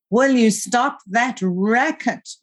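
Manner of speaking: slow, high, drawn-out scolding moan; pained moan. A narrator's reading. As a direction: moan it long and high through a migraine. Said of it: "Ma moaned from beneath her migraine".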